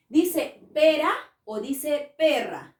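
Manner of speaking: pera with a tapped r, perra with a rolled rr; pera versus perra. Shown as a demonstration. Teacher quizzes class on pera and perra. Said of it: "suena fuerte la R".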